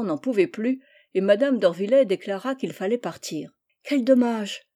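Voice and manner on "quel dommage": a child's cry of disappointment, lightly acted; disappointed exclamation. A narrator's reading. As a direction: exclaim with mild regret. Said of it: "s'écria Félicie".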